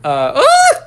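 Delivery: high loud yelp; excited shriek. Acted. Outburst searching for words.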